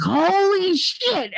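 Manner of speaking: high, slow stunned exclamation; mock shocked. Coworker reading the news on her phone, high slow disbelief. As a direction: drag it out in disbelief.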